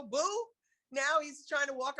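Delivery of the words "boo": mock boo.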